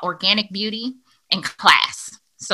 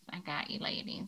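breathy whispered echo; whispering; 3 semitones lower, 21 dB quieter than usual. Shown as a demonstration. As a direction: whisper it back to them. Repeats the line, then whispers it.

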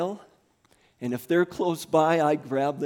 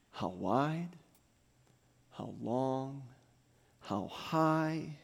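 softer, drawn-out prayer; hushed prayer; 7 dB quieter, slower than usual. Acted. Pastor praying love's measure over church, hushed. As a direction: let each phrase fall away quietly.

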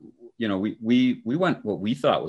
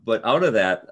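ordinary talk

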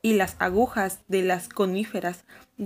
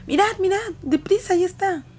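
Susan urges the others to look quick; urgent excitement; 11 semitones higher than usual. A narrator's reading. Susan urgently points out the creature. (susurro urgente no, grito agudo y apremiante)

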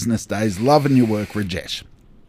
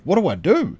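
mock panic, the second "What do I do?" higher with a rise; panicked; 5 semitones higher than usual. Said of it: "repeated, rising panic".

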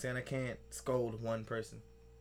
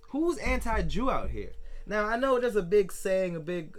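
incredulous; 6 semitones higher, 6 dB louder than usual. Real, not acted. Continuing disbelief.

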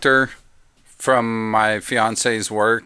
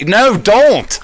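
alarmed shout; 9 semitones higher, 5 dB louder than usual. Real, not acted. Friend about to taste poison.

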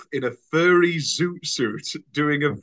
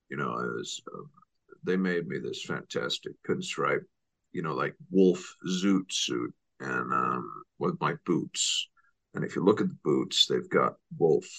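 mock Johnny Depp interview voice; 13 semitones lower, 7 dB quieter than usual. Quoting the actor's interview in a low mumble. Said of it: "hilarious interviews".

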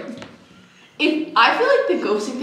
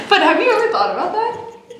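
nervous laughing; 9 semitones higher than usual. Real, not acted. Nervous laugh about death.

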